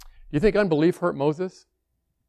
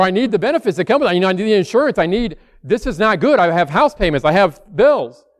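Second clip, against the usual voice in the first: voiced higher and louder, rushed and anxious; panicked worry; 5 semitones higher, 8 dB louder than usual. Worried churchgoer frets loudly about losing the job, anxious. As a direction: plead as if the job is slipping away. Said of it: "all of us would be like".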